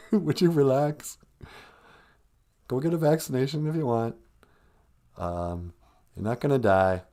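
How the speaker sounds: usual speaking voice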